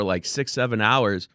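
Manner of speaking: ordinary talk, nothing marked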